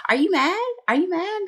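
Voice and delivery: high, teasing sing-song questions; childish taunting. Retold or imitated. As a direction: needle her like a bratty kid. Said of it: "the childish part of Stormi's personality chimes in".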